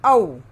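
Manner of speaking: औ called out loud as an open au sound; औ, loud drawn au vowel; clear vowel demo. Shown as a demonstration. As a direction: announce औ to the class, loud and open. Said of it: "ओ के बाद क्या आएगा?".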